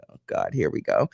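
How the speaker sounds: exasperated mutter, voice dropped; weary groan. Retold or imitated. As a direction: sigh it out like you've heard it all. Inner eye-roll at buzzword.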